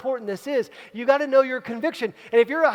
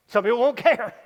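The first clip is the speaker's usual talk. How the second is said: laughing; 4 dB louder than usual. Acted. Repeats the line with a laugh.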